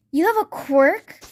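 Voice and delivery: loud, high, slow gasp of disbelief; shocked class. Acted. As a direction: blurt it out as if the whole class is stunned. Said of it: "the entire class is shocked".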